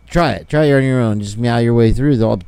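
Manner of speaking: usual speaking voice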